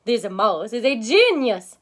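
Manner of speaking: loud, delighted exclamation; cried out. Acted. Professor praising the mouse to the class, loud and delighted. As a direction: proclaim it with delight. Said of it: "he cried".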